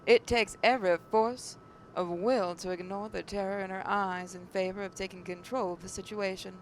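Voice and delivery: ordinary talk, nothing marked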